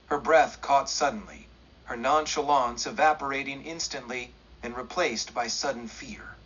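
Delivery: ordinary delivery, nothing marked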